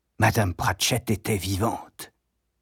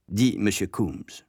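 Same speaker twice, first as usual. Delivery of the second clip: plain reading voice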